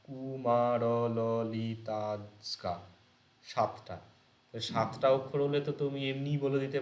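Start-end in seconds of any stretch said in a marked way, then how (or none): 0.0-2.7 কু, মা, র, ল, লি, তা, স্কা spoken one syllable at a time with pauses; syllables counted out. Shown as a demonstration. Teacher counts the syllables of a metre name for students, one at a time. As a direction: tap out each syllable of কুমারললিতা separately. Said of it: "সেখান থেকে তুমি syllable count করতে পারো".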